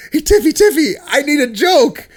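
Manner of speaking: high, loud-ish imitation of a flustered co-presenter; urgent mock plea. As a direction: mimic a pal urgently begging for help.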